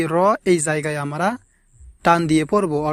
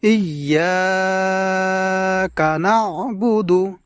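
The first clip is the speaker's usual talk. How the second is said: slow melodic Quran chant; correct recitation; 4 semitones higher, much slower than usual. Shown as a demonstration. Teacher reciting the verse correctly for viewers. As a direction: recite beautifully and slowly. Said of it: "সুন্দর করে শুদ্ধ উচ্চারণে".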